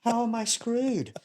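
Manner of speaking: high-pitched, drawn-out disbelief; acting out indignant bafflement; retold incredulous protest. Protesting being told he is screwed.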